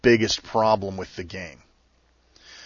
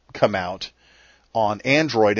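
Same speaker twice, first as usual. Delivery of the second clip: ordinary delivery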